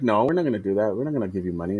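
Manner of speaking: lightly voiced kid digging in quietly; stubborn refusal. China stand refuses to pay USA, low mock refusal. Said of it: "And China's like".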